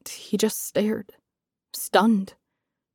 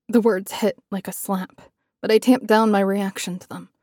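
ordinary delivery

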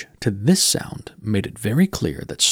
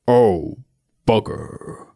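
Death's voice; 3 dB louder, much slower than usual. Acted. Skeleton falls on ice.

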